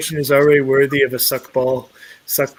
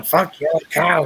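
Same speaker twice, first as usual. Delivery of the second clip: imitated sketch catchphrase, slow; Dave Chappelle; slower than usual. Co-host quoting a gif, slow imitated sketch voice. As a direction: drag out the mock curse. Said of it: "as played by Dave Chappelle, going".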